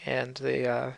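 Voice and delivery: ordinary talk, nothing marked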